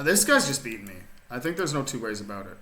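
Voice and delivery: usual speaking voice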